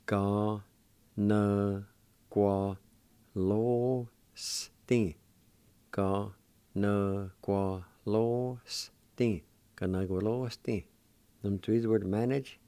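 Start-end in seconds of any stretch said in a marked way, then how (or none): 0.1-9.4 very slow teaching demo of every syllable. Shown as a demonstration. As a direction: drag out each syllable, separated. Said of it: "and it's pronounced like this".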